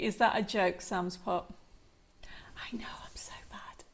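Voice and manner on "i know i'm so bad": breathy confessional whisper. Real, not acted. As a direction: drop to a secret whisper. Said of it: "I don't know why I'm whispering".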